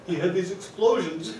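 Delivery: ordinary talk, nothing marked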